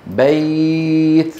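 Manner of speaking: بيت with "ay" held very long and level; leen like madd. Shown as a demonstration. Teacher compares leen to madd for students, held glide. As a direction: after the short a, sustain the y of بيت. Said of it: "الباقي هو مثل حرف المد".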